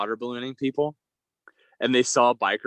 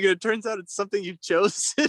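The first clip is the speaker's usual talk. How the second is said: lightly voiced, higher sincere tone; playing him dead earnest, pitched up; mock sincere biker; 6 semitones higher than usual. Quoting the biker's earnest remark, slightly higher. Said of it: "And he's like".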